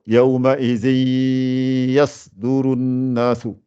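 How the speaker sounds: very slow yawma izi, nasal merge into ya; demonstrating idgham; slow recitation. Shown as a demonstration. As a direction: stretch yawma izi yasdurun nasu. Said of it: "son sort par le nez".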